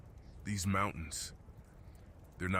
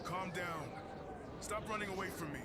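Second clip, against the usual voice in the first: high, soft call from a distance, carried on the storm; calling from afar; 13 semitones higher, 8 dB quieter than usual. Acted. Krex calling to a frightened lost hiker from far off, high and distant. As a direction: shout after them gently so they stop running. Calling after a fleeing stranger.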